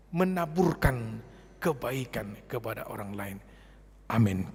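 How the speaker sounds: ordinary talk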